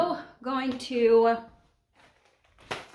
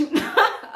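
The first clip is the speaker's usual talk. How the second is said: laugh; 11 semitones higher than usual. Real, not acted. Laughs at own answer.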